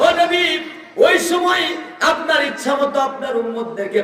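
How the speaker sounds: high, calling sermon chant; fervent preaching. Real, not acted. Preacher relaying God's promise of water to the Prophet, high preaching.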